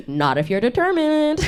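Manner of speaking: lightly voiced doctor; deadpan doctor. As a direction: psychiatrist answering dryly, lightly voiced. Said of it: "and he's like".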